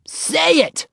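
put-on very high, bossy little-girl command; ordering. A narrator's reading. Ramona orders Henry to give the secret words, bossy. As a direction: demand it like a small child in charge. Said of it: "she ordered".

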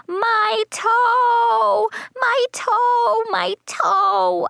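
put-on very high child's wail; drawn-out, hammed-up howl; pained holler. A narrator's reading. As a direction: holler it in exaggerated agony, way up high. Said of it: "I hollered real loud".

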